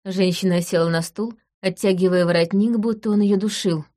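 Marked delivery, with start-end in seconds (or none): none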